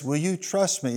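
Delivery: soft retold pastor question; quiet, patient pastoral voice; very calm. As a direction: stay unruffled, ask it gently. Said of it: "he just looked at him very calmly".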